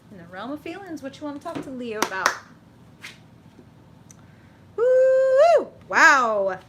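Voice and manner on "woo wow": high, very loud whoop of surprise; a real startled cheer; excited woo. Real, not acted. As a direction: shout out your delight at the card. Reacting to the drawn card.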